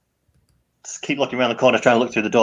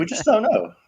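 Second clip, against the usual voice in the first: mock innocent; 11 semitones higher than usual. Joking in-character justification.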